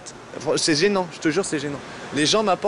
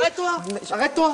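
stop yelling; 12 semitones higher, faster than usual. Acted. Star tells pestering fan stop.

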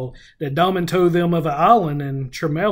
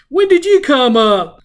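higher and louder, a bit startled; startled question; 8 semitones higher, 7 dB louder than usual. Retold or imitated. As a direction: blurt it out, caught off guard. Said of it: "And Rand was like".

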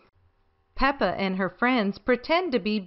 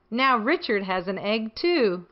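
ordinary delivery, nothing marked

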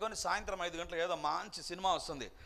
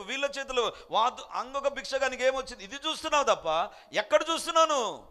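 louder and higher, chiding rebuke to the beggar; Peter scolding; 4 semitones higher, 6 dB louder than usual. Retold or imitated. Peter rebukes the distracted beggar, louder, higher and chiding. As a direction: scold the beggar for looking everywhere else. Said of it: "అందుకే పేతురు అంటున్నాడు".

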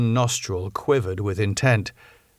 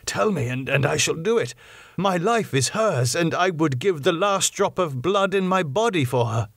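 put-on earnest young lover, higher pitch; passionate young man; 7 semitones higher than usual. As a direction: pledge your life fervently. Arthur pledges himself.

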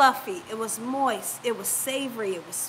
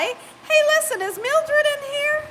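excited; 12 semitones higher than usual. Real, not acted. Excited greeting to viewer.

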